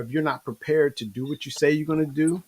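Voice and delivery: ordinary talk, nothing marked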